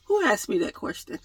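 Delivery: usual speaking voice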